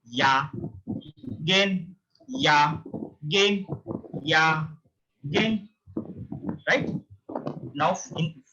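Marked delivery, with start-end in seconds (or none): 0.0-5.7 yagen split as ya and gen; syllable demo. Shown as a demonstration. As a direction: spell out ya, gen slowly. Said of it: "Try to read third one".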